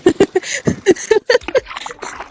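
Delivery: sudden high-pitched giggle; embarrassed high laugh. Real, not acted. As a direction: giggle at your own slip. Laughs at own mistake.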